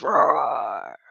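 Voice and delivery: low little growled roar; grandson's roar. Retold or imitated. Grandma retelling her grandson's roar, low growl. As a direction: growl it like a small boy playing lion. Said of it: "my grandson says".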